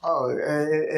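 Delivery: normal talking voice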